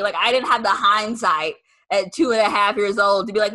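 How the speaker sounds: normal talking voice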